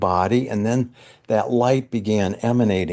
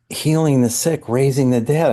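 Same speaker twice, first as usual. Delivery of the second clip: ordinary talk, nothing marked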